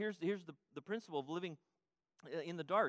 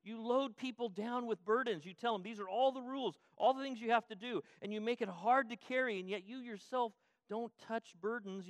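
stern denunciation; 5 semitones higher than usual. Acted. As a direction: call them out on the burdens. Jesus voiced denouncing the lawyers.

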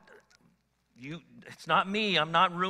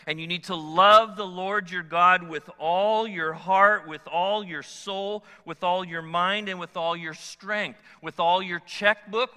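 loud, slow, rising preacher cadence; slow booming sermon delivery; full-force preaching; 2 semitones higher, 6 dB louder than usual. Acted. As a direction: build it like a pulpit crescendo. Preaching the great commandment.

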